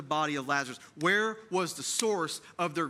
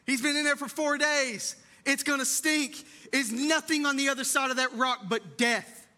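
alarmed protest; 6 semitones higher than usual. Retold or imitated. Martha objecting to Jesus at the tomb, alarmed and higher. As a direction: push back in alarm, voice lifted. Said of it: "Martha freaks out".

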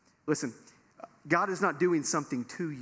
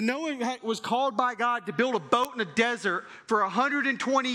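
preaching at full force; 4 semitones higher than usual. Acted. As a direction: preach it up to the congregation.

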